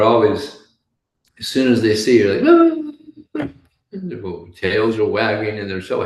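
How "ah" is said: very high, loud yelp; excited dog noise. Acted. Dog greeting its owner as soon as it sees them, excited yelp. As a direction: squeal like a dog thrilled at company.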